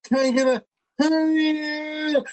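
high, long-held rallying holler; imitated kid's call-out. Seven-year-old son calling down a Walmart aisle, imitated drawn-out cheer. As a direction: imitate a kid calling down the aisle, stretching the last word. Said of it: "he's at the end of the aisle, and he's like".

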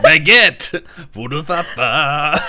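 loud mock French accent; hammed-up French accent, dragging the last words; silly French accent, close to laughing; Ratatouille. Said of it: "okay, channel my inner Ratatouille".

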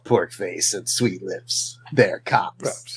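trailer voice. Pretend. Pitching a cop show title.

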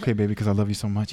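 fast, laughing lovey reply; mock devoted boyfriend. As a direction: rush it out as a half-joking sweet nothing. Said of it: "I'm like".

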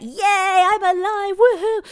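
high, loud, bubbly whoop of joy; squealing mock celebration; excited cheer. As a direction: burst out cheering like you just woke up thrilled. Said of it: "be like".

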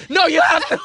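loud, high re-enacted demand; mock yell in an argument; insistent. Acted quarrel, insisting.

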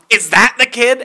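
high, pointed mock question; retold demand. Customer's wife pointing out coworker, mock demanding question.

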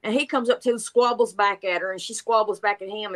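ordinary talk

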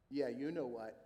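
low, knowing parrot aside; insinuating parrot. Acted. Parrot to customer while owner watches, low insinuation. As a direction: drop the pitch and leave the insult implied.

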